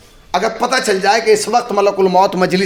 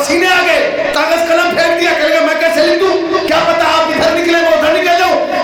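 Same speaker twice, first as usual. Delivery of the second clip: high, loud impassioned sermon cry; shouted majlis oration; preaching at full force; 9 semitones higher, 4 dB louder than usual. Acted. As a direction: rouse the gathering with fervour.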